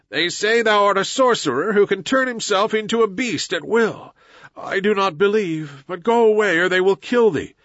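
high, pleading woman's voice; mother crying out. A narrator's reading. Messua begs her son to flee the villagers, crying out. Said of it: "ran across to the herd and cried".

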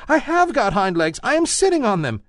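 high, small insistent rabbit voice; little rabbit protesting. A narrator's reading. Toy rabbit defending himself to the wild rabbit, high voice. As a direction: insist in a tiny bunny voice. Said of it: "cried the little rabbit".

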